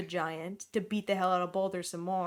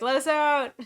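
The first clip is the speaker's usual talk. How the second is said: mock call; 6 semitones higher, 6 dB louder than usual. Characters signal the snake.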